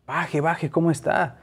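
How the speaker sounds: retelling a trucker calling into a wrecked car, mild urgency; urgent calling out. Retold or imitated. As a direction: call him out of the car, worried. Truckers calling to stunned driver.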